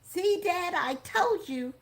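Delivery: high-pitched "told you so"; excited young girl. A narrator's reading. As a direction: chime in like a thrilled teenage daughter. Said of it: "Jan chimed in".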